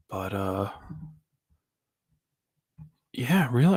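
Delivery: soft whisper. Real, not acted. Whispered aside.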